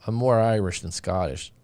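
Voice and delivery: usual speaking voice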